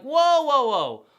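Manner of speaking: loud startled whoa, pitch jumping up then dropping; alarmed shout. Pretend. Imagining Jesus stopping the crowd with a loud alarmed whoa. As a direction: cut them off loudly before it goes further. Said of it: "I would have been like".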